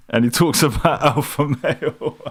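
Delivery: talking through real laughter; giggling while mocking the clip's alpha talk; laughing. Real, not acted. Streamer laughing at the clip as he speaks.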